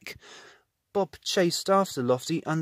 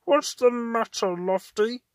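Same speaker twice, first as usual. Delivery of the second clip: sleepy rumble; 7 semitones higher, slower than usual. A narrator's reading. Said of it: "rumbled Roly sleepily".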